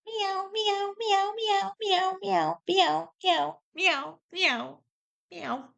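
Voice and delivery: high mimicked meows, gradually coming down in pitch; repeated cat meows. Cat answering its owner's questions, insistent high meows. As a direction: meow on and on like a demanding cat. Imitates the cat's endless meowing.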